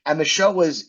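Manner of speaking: normal talking voice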